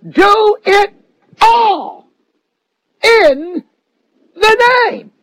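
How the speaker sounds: high, ringing proclamation; preached shout. Acted. Preacher proclaiming the scripture to the congregation, ringing shout. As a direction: cry it out from the pulpit.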